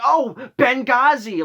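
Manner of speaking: loud, drawn-out mock cry; mocking outburst. Manager answering talk about Hillary, mockingly loud.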